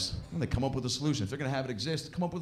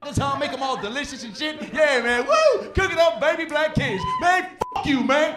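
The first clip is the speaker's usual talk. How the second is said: high shouted mock heckler; heckler yelling; 11 semitones higher, 6 dB louder than usual. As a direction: whoop and yell it at the comic. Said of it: "He was like".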